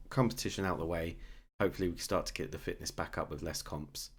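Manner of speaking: ordinary delivery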